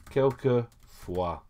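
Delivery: quelquefois said slowly, syllable by syllable, as a French model; slow model word. Shown as a demonstration. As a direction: spell out quelquefois slowly for learners. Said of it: "lovely word".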